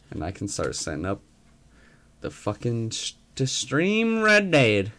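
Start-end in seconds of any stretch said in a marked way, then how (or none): 3.3-4.9 slow, high western cowboy drawl. Pretend.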